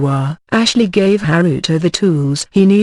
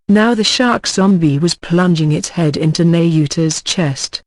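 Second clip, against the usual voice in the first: usual speaking voice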